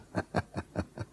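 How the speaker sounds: a soft, breathless wheezing snicker; wheezy snicker. Acted. Landlord to his new lodger, soft wheezy snicker. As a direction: snicker under your breath at the fine gentleman.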